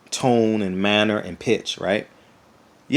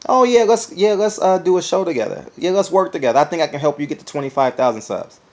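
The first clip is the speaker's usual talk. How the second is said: much higher, louder sugary pitch; fake friendly rival; 7 semitones higher, 3 dB louder than usual. Rival YouTuber begging to collaborate, fake sweet. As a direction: oversell the friendship offer. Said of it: "What am I gonna say?".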